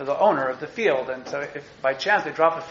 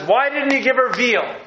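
high, louder mock outrage; mock indignant question; 8 semitones higher, 4 dB louder than usual. Teacher voicing the midrash scolding Boaz, mock indignant. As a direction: play the midrash demanding more, half-joking. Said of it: "But this midrash says".

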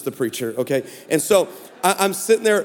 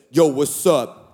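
much lower, cool greeting; Antoine's texting voice; 8 semitones lower, slower than usual. Retold or imitated. Stranger texting out of the blue, low and cool. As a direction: drop it low like a smooth texter. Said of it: "actually it was like this".

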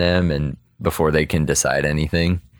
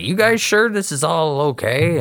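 higher, doubtful imitation; pitched-up hesitant query in a character voice; awkward unease; 11 semitones higher than usual. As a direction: act out an uneasy question, voice lifted. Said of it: "starting to feel kinda awkward".

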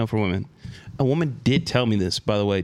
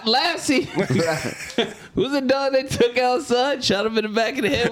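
laughing; 10 semitones higher than usual. Real, not acted. Laughing recalling dog.

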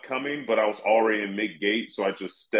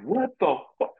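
hearing about the sinkhole rats, higher shocked outburst; 9 semitones higher than usual. Retold or imitated. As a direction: blurt it out in shock. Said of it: "I was like".

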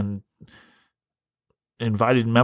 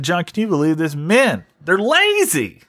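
imitating Priscilla; 6 semitones higher than usual. Priscilla telling John men are lazy, mock high complaint. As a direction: stretch out they're lazy in disbelief. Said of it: "She said".

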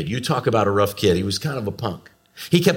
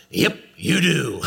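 put-on slow, higher toddler voice; cocky little boy; 4 semitones higher, slower than usual. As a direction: imitate a self-assured three-year-old. Imitates three-year-old son's cocky reply.

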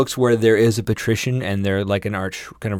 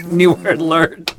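announcer voice; 8 semitones higher, 5 dB louder, slower than usual. Acted. Announcing jingle phrase.

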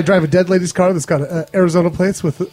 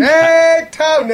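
high, drawn-out group hello; big hearty welcome, pitched up; cheering greeting; 8 semitones higher, much slower than usual. Real, not acted. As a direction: hail a pal in a high held note.

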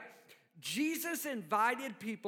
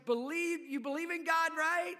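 higher questioning Jesus; 6 semitones higher than usual. Retold or imitated. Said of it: "Jesus going".

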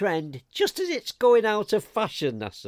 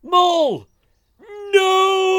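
high desperate cry of no; anguished shout; 13 semitones higher, 7 dB louder, slower than usual. Acted. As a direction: yell it in horror. Pleading against giving in.

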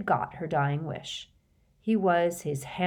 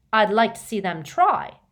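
loud, sneering dare; 4 semitones higher, 8 dB louder than usual. Acted. Riddle defying anyone to force him, sneering. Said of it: "sneered Riddle".

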